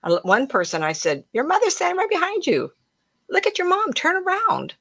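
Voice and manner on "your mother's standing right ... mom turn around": much higher, pushy urging; frustrated calling. Retold or imitated. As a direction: insist impatiently that the ghost turn. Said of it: "It didn't say it like that".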